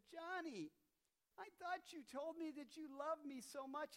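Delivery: a mother calling out her son at the door; disappointed mom. Retold or imitated. Mom voiced in story.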